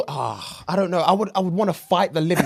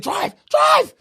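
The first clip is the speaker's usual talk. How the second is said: frantic shout. Retold or imitated. Retelling the taxi escape, screaming at the driver. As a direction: yell at the driver to go.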